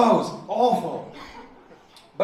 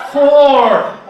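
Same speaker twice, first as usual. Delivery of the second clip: much louder and higher, drawn out; loud correction; 7 semitones higher, 14 dB louder than usual. Retold or imitated. Teacher correcting the class, loud emphatic word. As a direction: drill the word hard for the class. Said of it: "one more time".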